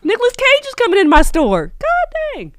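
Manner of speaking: high, wailing excitement; freaking out. Real, not acted. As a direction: lament it high in starstruck disbelief. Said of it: "I'm freaking out".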